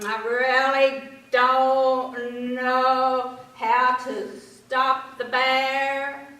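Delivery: drawn-out frog mulling it over. A narrator's reading. Frog voiced croaking ribbit.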